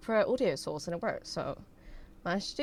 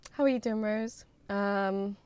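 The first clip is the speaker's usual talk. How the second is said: ordinary talk, nothing marked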